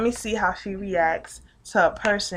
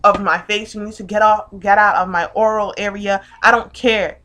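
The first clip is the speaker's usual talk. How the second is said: loud, forceful outburst; angry telling-off; 11 dB louder than usual. Retold or imitated. Telling man to back away from her, furious. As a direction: lay into him at full volume.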